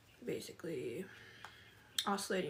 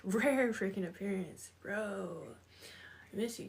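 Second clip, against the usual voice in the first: ordinary talk, nothing marked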